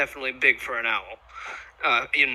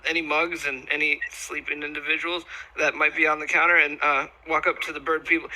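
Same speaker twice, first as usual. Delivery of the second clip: ordinary delivery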